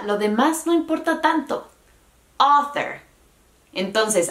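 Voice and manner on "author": "Author" with a strong, high AH, light ther; stressed first syllable. Shown as a demonstration. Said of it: "la sílaba tónica bien pronunciada".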